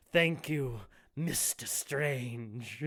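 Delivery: villain mockingly thanks his captive, slow and soft; drawn-out menace.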